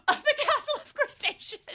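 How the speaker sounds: high-pitched squeal through laughter; laughing re-enactment. Retold or imitated. Fan arriving still amused by the chapter, laughing. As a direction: crack up while quoting it.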